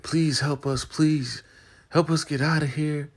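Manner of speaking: high, pleading plea; imitating Lynn begging to be freed, very high.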